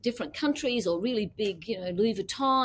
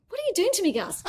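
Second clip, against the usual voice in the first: a playfully choked-up, much higher and louder plea; mock emotional; 12 semitones higher, 4 dB louder, faster than usual. To husband Gus, playfully faking getting emotional. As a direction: ham up getting teary at the husband. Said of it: "I'm gonna get emotional".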